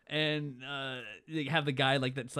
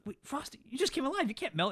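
high-pitched disbelieving retort; mock incredulity; 10 semitones higher than usual. Kid objects to Frosty's claim he'll melt, mock incredulous. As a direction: question it in a high put-on voice.

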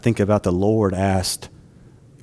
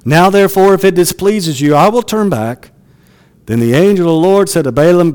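loud, ringing scripture read to the congregation; 6 semitones higher, 5 dB louder than usual. Acted. Preacher reading Balaam's story aloud, loud and declaiming. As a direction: proclaim the passage with full force.

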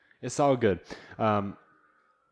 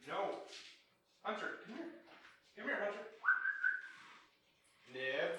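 calling the dogs; 9 semitones higher, 15 dB quieter than usual. Real, not acted. Calling dogs from another room.

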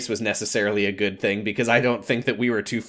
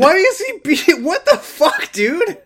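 outraged disbelief; 6 dB louder, slower than usual. Real, not acted. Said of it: "outraged laughing reaction".

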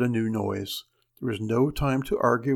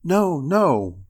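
panicked; 4 semitones higher, 6 dB louder than usual. Acted. Panicked wife refusing husband.

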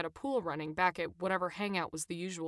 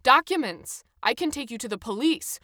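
loud, higher-pitched demand; shouted threat; 5 semitones higher, 8 dB louder than usual. A narrator's reading. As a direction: threaten the passengers in a raised voice. Footballer's threat repeated in English.

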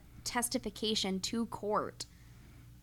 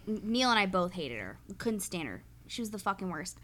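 normal talking voice

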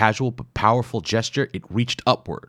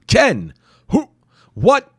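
very high, loud confused cries; startled; 9 dB louder, slower than usual. Acted. Wimple startled at the scene, high and loud. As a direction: sputter in panic.

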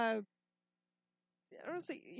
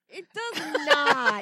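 disbelief; 11 semitones higher, 9 dB louder, much slower than usual. Real, not acted.

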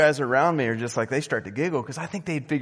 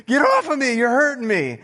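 imitating a panicked friend, high and loud; mock-terrified high shriek; cracking girly scream; 11 semitones higher, 8 dB louder than usual. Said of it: "his voice was cracking".

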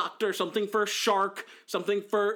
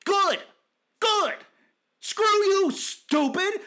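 gleeful jeer; 5 dB louder, much slower than usual. Retold or imitated. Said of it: "and I'm like".

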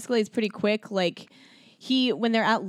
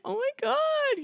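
high drawn-out awed squeal; mock swooning "oh my God"; starstruck squeal. Retelling her awed exit from limo.

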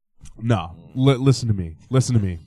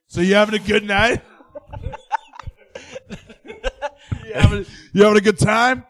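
mock cheesy singer over the P A, higher; a lightly hammed-up lounge singer working the room; 7 semitones higher than usual. Singer on the P A to diners, hammed-up cheesy patter. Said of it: "start asking them questions".

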